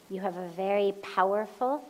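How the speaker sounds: ordinary delivery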